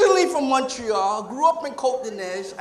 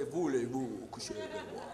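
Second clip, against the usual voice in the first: "Voulez-vous coucher avec moi?" in a much lower, softer Parisian voice; Parisian French; 15 dB quieter than usual. Retold or imitated. Comic imitating a suave Parisian, lower, softer Parisian French. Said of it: "somebody from Paris would say".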